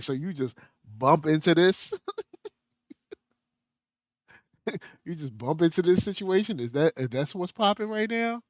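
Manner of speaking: mock indignant sisters. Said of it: "sisters was mad about that joint".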